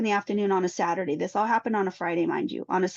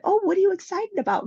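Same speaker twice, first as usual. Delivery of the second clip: higher, sing-song interest; bright cheery question; 11 semitones higher, 3 dB louder than usual. Retold or imitated. Mother to her seven-year-old daughter, higher cheery question. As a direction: keep it sounding normal for the child.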